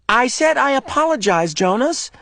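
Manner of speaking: high impatient voice; boy impatiently interrupting; irritated interruption. Acted. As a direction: cut him off.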